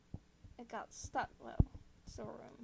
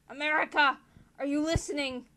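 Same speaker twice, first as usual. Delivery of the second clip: sudden loud, higher call; sharp, raised-voice scolding; calling to inattentive listener; 4 semitones higher, 10 dB louder than usual. Acted. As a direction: snap someone to attention, loudly. Germany scolding inattentive America.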